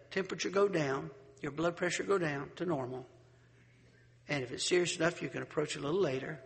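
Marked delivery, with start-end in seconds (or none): none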